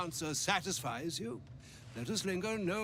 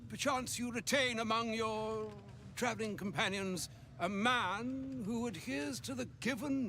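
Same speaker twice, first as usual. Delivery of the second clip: lightly voiced courtly old wizard; a touch of an elderly sage speaking formally; 6 semitones higher, 3 dB louder than usual. Game character voice, Elminster.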